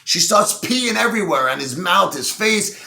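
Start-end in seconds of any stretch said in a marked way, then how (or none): none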